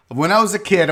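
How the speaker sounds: normal talking voice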